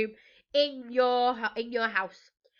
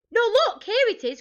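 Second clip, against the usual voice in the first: high, loud cry of discovery; pirate crying out at a find; excited cry; 12 semitones higher, 9 dB louder than usual. A narrator's reading. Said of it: "cried Peg Polka Dot".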